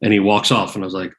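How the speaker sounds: ordinary talk, nothing marked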